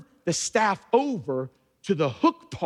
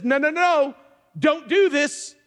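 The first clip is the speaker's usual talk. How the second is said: higher, louder urgent no; urgent forbidding voice; 8 semitones higher, 4 dB louder than usual. Acted. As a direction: stop him hard, like the Spirit warning Joseph. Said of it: "the pressure says".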